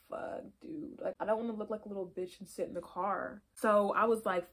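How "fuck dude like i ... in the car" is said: much softer, half-whispered worry; debating going inside, whispered dread; whispered inner dread. Retold or imitated. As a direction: mutter it under your breath, dreading it. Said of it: "I'm like".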